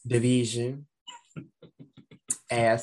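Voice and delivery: usual speaking voice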